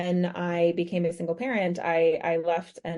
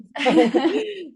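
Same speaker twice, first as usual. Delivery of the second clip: high, loud bright burst of laughter; real laugh; 11 semitones higher, 7 dB louder than usual. Real, not acted. Guest laughing at her own modest correction, high and loud.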